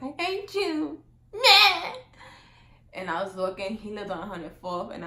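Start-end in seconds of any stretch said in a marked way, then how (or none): 0.0-1.9 high, loud hammed-up pout; mock tantrum. Vlogger re-enacting storming off from boyfriend's house, mock tantrum. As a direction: throw a mock lovers' fit. Retold tantrum leaving in rain.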